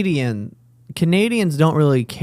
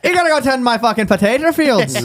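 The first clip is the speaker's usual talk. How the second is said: a light put-on Irish accent; mock Irish accent; 7 semitones higher than usual. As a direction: play an angry Irish bigot, lightly. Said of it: "he doesn't like when I do the Irish accent".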